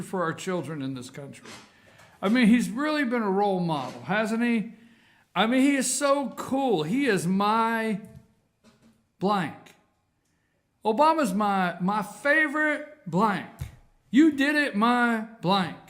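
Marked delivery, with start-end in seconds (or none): 2.2-9.7 higher, louder sarcastic gushing; mock admiration. Real, not acted. Host sneering about the president as role model, sarcastic. 10.8-15.8 loud, high-pitched sarcastic proclaiming; mock admiration. Host mocking the dinner remark, loudly sarcastic.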